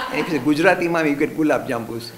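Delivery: fast, loud words through laughter; laughing while talking. Real, not acted. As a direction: chuckle through the line.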